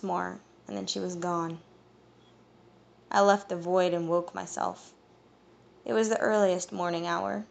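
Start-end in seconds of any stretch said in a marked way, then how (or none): none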